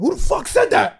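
sudden high, loud demand; angry teacher outburst. Retold or imitated. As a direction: imitate a nice teacher exploding. Retold substitute snaps at insult.

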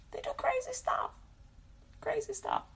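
soft breathy aside; hushed, whispered repetition. Real, not acted. Repeating crazy stuff aside.

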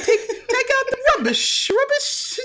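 very high, hammed-up British; bad British accent. Shown as a demonstration. Co-host showing what her British would sound like, hammed-up British accent. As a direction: squeal it in a failed British accent, breaking into laughter. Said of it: "let me hear your British accent".

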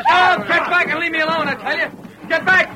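high, frantic cries; panicked struggle. Acted. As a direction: fight off the men grabbing you. Man seized by lynch posse.

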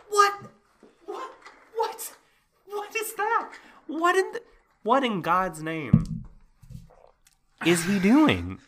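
can't believe what he's seeing; incredulous. Real, not acted. Disbelief at the plot twist.

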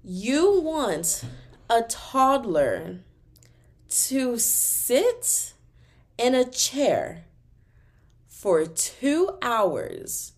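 each phrase slowed with long pauses; slow emphatic. Acted. Mocking parents who bring toddlers, slow and emphatic. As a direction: drag it out word by word for effect. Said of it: "slow emphatic rhetorical build".

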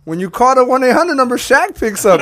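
high, loud retold urging; excited insisting. Host imitating his friend selling the insurance, excited and loud. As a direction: push it hard, nearly cracking up.

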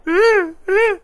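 high, loud, slow mock wail; squealing hammed-up sobbing; crying. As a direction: bawl like a sore loser, ending in sobs. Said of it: "[name]'s crying".

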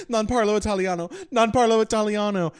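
high, loud panicked repeat; backing away in mock panic. Tourist panicking at the shouting lady, high and loud. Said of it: "and I'm like".

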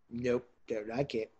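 slow, fussy, laughing refusal; voicing the ear. Voicing a picky ear rejecting a bad accent, amused. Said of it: "your ear is going".

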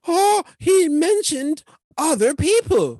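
high, drawn-out pearl-clutching outrage; mock offended critic. Mocking easily offended people who object, high scandalized whine. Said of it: "anybody who's gonna be like".

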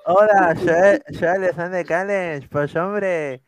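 lightly put-on Spain accent, slow start; a hint of a Spaniard's voice; mock Spanish accent of Callens. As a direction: play Callens who picked up a Spanish accent. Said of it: "ya se le pegó el acento".

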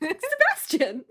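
high, slow, sweetly drawn-out name; cutesy goody two shoes. Retold or imitated. Podcaster mimicking an islander's cute smile, cutesy and high. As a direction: imitate her cute little smile. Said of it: "cute ass little smile".

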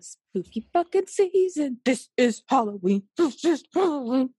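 hammed-up spooky announcement; spooky Halloween voice. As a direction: stretch it out like a playful ghoul. Spooky chanted echo of the theme.

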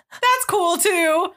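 high, drawn-out, awkward acceptance; feigned okay. Retold or imitated. Gym man hearing they are dating, awkwardly high and slow. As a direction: stretch it out, covering the letdown. Crestfallen man covering disappointment.

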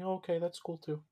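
a hint of a let-down kid; lightly voiced Jeremy. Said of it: "voicing Jeremy".

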